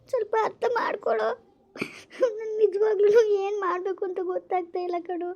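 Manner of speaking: sobbing as she begs him to understand; crying. Acted. Girlfriend pleading, breaking down. (ಅಳುತ್ತಾ ಬೇಡಿಕೊಳ್ಳುವುದು)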